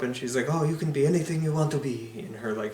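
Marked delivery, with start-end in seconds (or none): 0.5-2.1 lightly voiced Swedish chef, quick and sing-song; a hint of the Muppet Swedish chef, fast. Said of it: "Swedish chef voice".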